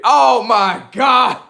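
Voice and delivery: high, slow, loud exasperation; exasperated high outcry. Real, not acted. Streamer fed up with starstruck fans, high exasperated outcry. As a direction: throw your voice up in disbelief.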